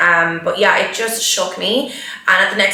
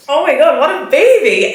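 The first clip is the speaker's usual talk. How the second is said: high, loud, laughing at her own tears; self-mocking laugh; 8 semitones higher, 4 dB louder than usual. Real, not acted. Runner laughing at herself for crying after the marathon, amused. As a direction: tease yourself through a laugh.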